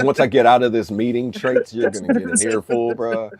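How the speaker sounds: hammed-up teasing threat; mock threat. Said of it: "It's like".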